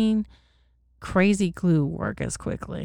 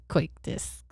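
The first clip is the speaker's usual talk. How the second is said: ordinary delivery, nothing marked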